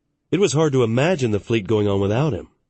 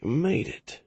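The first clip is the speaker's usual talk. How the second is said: faint, strained and soft; dying man barely speaking; weak voice; 5 semitones higher, 6 dB quieter, slower than usual. A narrator's reading. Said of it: "Kenseth spoke in a weak voice".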